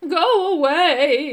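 long, loud, high wail of a heartbroken werewolf; blubbering cry. A narrator's reading. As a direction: wail it slowly, drawing out each word. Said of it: "it cried again".

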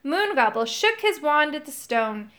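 ordinary delivery, nothing marked

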